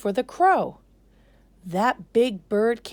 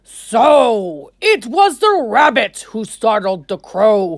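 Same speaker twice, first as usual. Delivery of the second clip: louder, booming lion king; king's voice; 12 dB louder than usual. Acted. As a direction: announce it like a ruler. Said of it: "said to the council".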